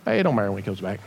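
lazy, indifferent imitation; mock apathy. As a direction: shrug it off, dropping the pitch way down. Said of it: "get caught up in apathy".

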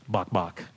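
low, soft spoken clucks; two understated, deadpan chicken clucks. Acted. Chicken chatting to the pig, soft clucking. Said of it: "chicken looks at the pig and goes".

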